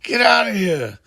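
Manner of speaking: furious high-pitched shout; a blind colonel roaring at the boy to leave; angry yell. Retold or imitated. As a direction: yell at him to get out of the room. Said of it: "Frank is just pissed off".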